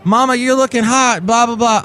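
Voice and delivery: high-pitched, gushingly friendly store worker; Sean. Retold or imitated. Store worker fawning over everyone else in line, chummy. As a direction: greet favourite customers with exaggerated chumminess. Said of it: "he's back to being Sean again".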